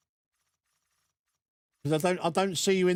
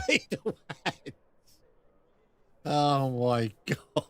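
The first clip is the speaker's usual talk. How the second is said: laughing; 3 semitones lower, slower than usual. Real, not acted. Laughing at another name.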